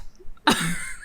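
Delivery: breathy unvoiced giggle; whispered giggle. Real, not acted. Streamer giggles at own pun, hushed. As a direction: laugh under the breath.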